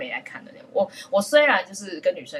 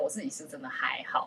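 soft whispered confession; whispered aside; 5 semitones lower, 10 dB quieter than usual. Real, not acted. Telling her co-host she's really fine, whispering. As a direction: drop to a whisper, almost to herself.

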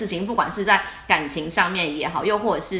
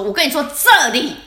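mock annoyed teacher; 5 semitones higher, 6 dB louder than usual. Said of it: "很不爽".

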